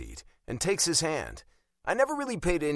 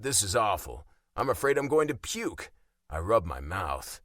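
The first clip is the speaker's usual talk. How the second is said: ordinary delivery, nothing marked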